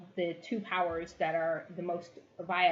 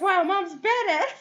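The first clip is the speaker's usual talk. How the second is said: put-on high, slow child's voice, laughing; awed little girl; 13 semitones higher, 9 dB louder, slower than usual. Lila watching her mother threaten the guard, awed. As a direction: gasp it like an amazed kid. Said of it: "Lila's like".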